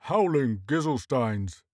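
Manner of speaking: understated, drawn-out moan; slow, groaned exclamation. A narrator's reading. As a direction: moan it out slowly. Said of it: "Horseface moaned".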